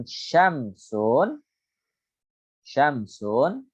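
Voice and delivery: syamsun drawn out slowly, sh clear; slow syamsun demo. Shown as a demonstration. Teacher introducing syamsiyah example, slow syamsun. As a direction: stretch syamsun for the class. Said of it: "huruf shin termasuk daripada hurufus syamsiyah".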